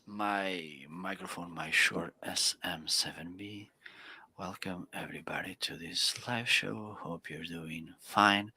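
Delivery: low, hushed radio host intro. Shown as a demonstration. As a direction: drop deep and soft, close on the mic. Demonstrates microphone with a show-host voice.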